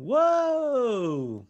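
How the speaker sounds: high, long drawn-out whoa; surprised whoa. Real, not acted. Reacting to movie scene.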